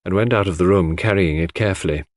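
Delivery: usual speaking voice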